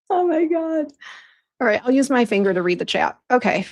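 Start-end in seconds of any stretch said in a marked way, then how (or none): 0.1-0.9 high, exasperated oh my God; a high exasperated exclamation. Real, not acted. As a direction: let it fly up in disbelief. Chaos during the livestream.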